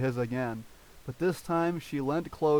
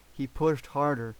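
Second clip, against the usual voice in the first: usual speaking voice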